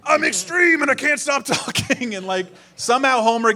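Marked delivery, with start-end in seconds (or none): 0.1-2.2 high, drawn-out cartoon mascot voice; extreme cartoon dog. Comic imagines Poochie talking nonstop, hyped-up cartoon voice. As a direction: play an over-the-top cartoon dog, slow and high. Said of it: "He's like".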